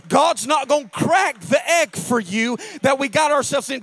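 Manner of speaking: loud, high-pitched sermon climax; full-force preaching. Real, not acted. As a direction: drive every phrase home to the congregation.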